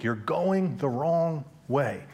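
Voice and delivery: slow, deliberate and even; slow, echoing line. Shown as a demonstration. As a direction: let it linger like a memory. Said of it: "going through my head all week".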